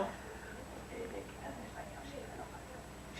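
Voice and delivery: soft unvoiced aside; a quick hushed aside; whispered aside. Real, not acted. Storyteller whispers an aside about the language. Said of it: "hushed aside".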